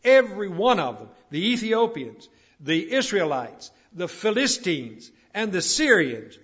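loud, punched list from the pulpit; forceful preaching. Real, not acted. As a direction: hammer each phrase out to the congregation.

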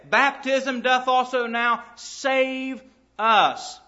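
each word drawn out slowly; slow, weighty scripture repeat; slow emphatic reading. Acted. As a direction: lay the verse down word by word, slowly. Sermon repeats verse slowly.

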